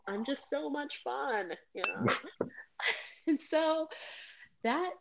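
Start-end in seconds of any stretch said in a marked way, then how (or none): 0.1-1.5 higher, animated self-quote; breezy bravado. Retold or imitated. Woman assures friends she'll party again, breezy bravado. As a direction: play at being the fun one. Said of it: "and be like".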